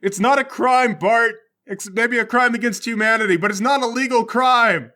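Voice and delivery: loud, high exasperated rebuttal; shouted argument. Acted. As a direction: argue at full volume mid-escape.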